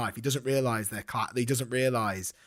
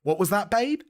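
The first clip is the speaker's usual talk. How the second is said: higher, louder wife voice; mock wife; 9 semitones higher, 6 dB louder than usual. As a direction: call over like a curious wife. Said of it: "his wife at the time says".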